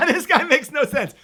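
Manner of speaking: reads the comment through loud high laughter; bursting out laughing mid-sentence. Real, not acted. Teacher laughs reading a viewer's mocking comment aloud, loud laughter.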